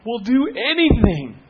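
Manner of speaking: high, loud, drawn-out desperation; imitated bawling plea. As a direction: plead like a desperate couple, dramatised. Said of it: "they're just bawling".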